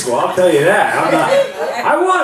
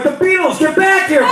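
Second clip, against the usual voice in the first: high mock call after the son; mock dad call; 14 semitones higher than usual. Dad calling his son back, high mock call. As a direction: shout it up high like a goofy dad. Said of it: "I'm like".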